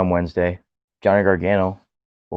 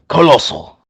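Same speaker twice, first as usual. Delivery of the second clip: loud, high attempt at an Australian accent; 7 semitones higher, 9 dB louder than usual. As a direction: shout it with a failed Australian accent. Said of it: "I can't do a, a Australian accent".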